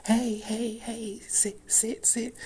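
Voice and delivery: very high-pitched puppy-training voice; talking to a puppy. Shown as a demonstration. Showing how someone talks to a puppy, high cooing. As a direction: coo high commands as if to a puppy. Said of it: "talk to a puppy".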